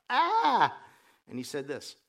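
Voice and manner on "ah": loud mock cry of alarm; scared outburst. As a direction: let out a drawn-out, alarmed "ah!". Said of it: "It's always scary".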